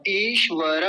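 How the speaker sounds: ईश्वर with held ee and full ra; correct long ई. Shown as a demonstration. Teacher answering what it should be, long-vowel pronunciation. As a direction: say ईश्वर as eesh-va-ra. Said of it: "क्या होगा".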